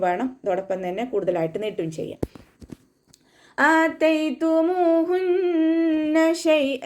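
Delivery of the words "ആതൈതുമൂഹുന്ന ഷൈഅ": slow, high, drawn-out Quranic chant; measured tajweed recitation. Shown as a demonstration. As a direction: recite it in tajweed, stretching each sound. Recites verse between explanations.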